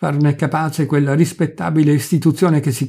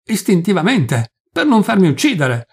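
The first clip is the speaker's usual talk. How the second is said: raised-pitch, heated self-defence; angry cry; 6 semitones higher, 3 dB louder than usual. A narrator's reading. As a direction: protest hotly, pitch climbing. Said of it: "gridò il Corsi, levando il volto rabbiosamente contratto".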